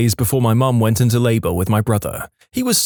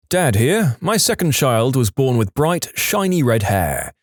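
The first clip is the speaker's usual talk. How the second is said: ordinary delivery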